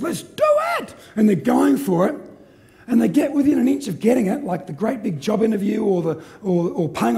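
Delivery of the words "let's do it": high-pitched mock battle cry; hammed-up rallying shout; gung-ho inner voice. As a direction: yelp it like an over-keen motivator. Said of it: "Part A says".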